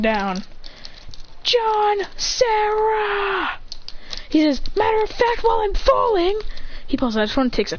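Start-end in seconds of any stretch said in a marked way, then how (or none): 1.4-3.6 high, very drawn-out call; falling cry. Acted. Acted cry while falling. 4.7-6.4 high-pitched character voice; mid-fall announcement. Acted. Acted speech while falling.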